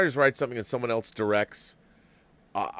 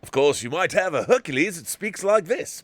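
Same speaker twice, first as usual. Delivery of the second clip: higher, lively Australian-tinged accent; 6 semitones higher, 4 dB louder than usual. Shown as a demonstration. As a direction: attempt a British hero, slipping into Australian. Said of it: "that's more of an Australian".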